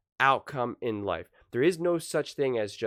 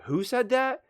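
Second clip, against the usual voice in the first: imitated reply leaping up high; high-pitched mock query; retold question; 12 semitones higher than usual. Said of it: "and he's like".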